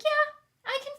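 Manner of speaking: high squeak of discomfort; high squeaky yes. Retold or imitated. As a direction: squeak it out like a startled patient. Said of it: "I was like".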